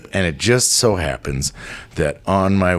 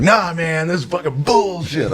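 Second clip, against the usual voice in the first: tough-guy protest; 8 semitones higher than usual. Retold or imitated. Acting tough in the cell.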